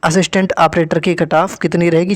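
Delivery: ordinary talk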